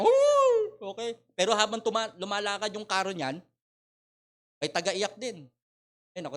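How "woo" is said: very high, loud hammed-up wail; mock funeral wail for hired mourners; mock mourner's wail. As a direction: cry out like a hired mourner, overdone.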